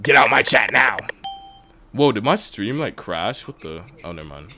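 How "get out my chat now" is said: loud, forceful dismissal; abrupt raised-voice command; sudden shouted order. Real, not acted. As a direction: yell the viewer out of chat. Ordering the banned viewer out.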